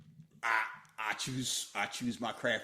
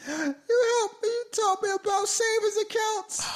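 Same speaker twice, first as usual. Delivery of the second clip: loud, high squeaky lady voice; high mocking falsetto; 9 dB louder than usual. Mocking the queen's voice.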